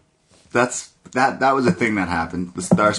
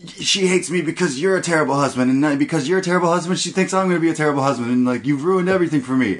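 mock scolding; 6 semitones higher, faster than usual. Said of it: "Rogen's scolding him and he's like".

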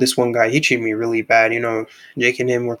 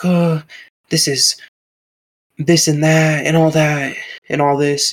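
imitating a scolding grandma, higher and slower; huffy, drawn-out telling-off; mock angry grandma; 4 semitones higher, slower than usual. Grandson quitting by phone, grandma's scolding mocked higher. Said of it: "And she got pissed".

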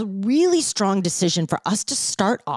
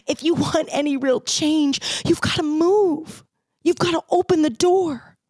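impassioned plea; 7 semitones higher than usual. Real, not acted. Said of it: "impassioned call, cry heard".

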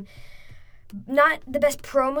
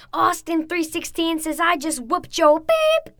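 much higher, slower snarling Stone Cold promo; imitating Stone Cold; 6 semitones higher, 3 dB louder than usual. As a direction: taunt the old preacher, building to the punchline. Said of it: "he went".

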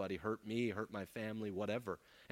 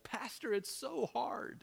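higher, slow, whiny complaint; mock whine; 14 semitones higher, slower than usual. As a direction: mimic a self-pitying church member. Imitating a complaining congregant.

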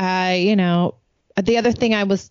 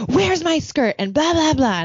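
imitating a stomping teen, much higher; high-pitched mock tantrum demand; 10 semitones higher than usual. Daughter stomping around hunting for her skirt, in a tantrum. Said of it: "she's stomping around".

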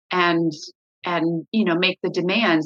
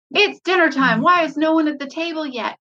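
impatient call; 8 semitones higher than usual. Retold or imitated. Mom calling kids, getting impatient.

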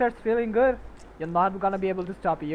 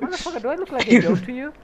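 laughing retort; 4 semitones higher, faster than usual. Retold or imitated. Retold retort to friend.

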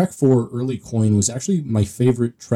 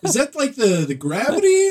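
high mock question; 13 semitones higher than usual. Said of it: "You're like".

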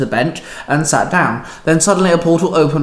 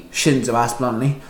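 ordinary delivery, nothing marked

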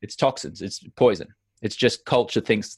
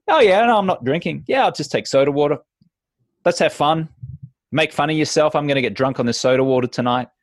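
much higher, bright and quick; breezy fun refusal; light and upbeat; 4 semitones higher than usual. Shown as a demonstration. Guest shown the right way, bright and quick. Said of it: "But in a".

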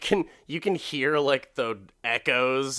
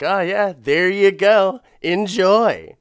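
slow, much louder, higher mocking serve; sarcastic; 8 semitones higher, 10 dB louder, slower than usual. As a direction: drop the bowl and sneer "enjoy". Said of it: "she's like".

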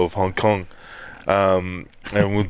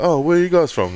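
much higher, squeaky mock voice of a stranger; imitating old mate; 9 semitones higher than usual. Stranger asking the boys where they're from, mocked much higher. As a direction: pitch it way up like a nosy bloke. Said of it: "he was like".

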